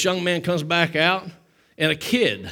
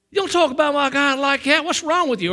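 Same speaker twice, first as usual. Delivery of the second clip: higher-pitched, indignant rebuke; imitating a self-righteous scolding; mock outrage; 8 semitones higher than usual. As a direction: scold him as the overzealous believer would. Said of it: "we can gear up and".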